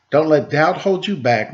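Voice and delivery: usual speaking voice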